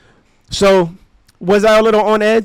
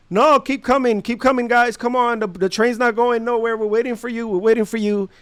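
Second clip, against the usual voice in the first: higher, sing-out operator imitation; ride operator; 5 semitones higher than usual. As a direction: call riders aboard like a patient attendant.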